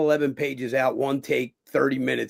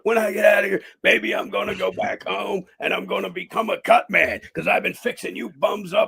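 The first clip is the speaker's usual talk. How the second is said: voicing his character; 8 semitones higher than usual. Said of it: "my character's going".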